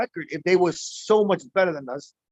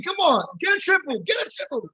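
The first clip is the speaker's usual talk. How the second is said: cheering on; 12 semitones higher than usual. Real, not acted. Cheering the batter on.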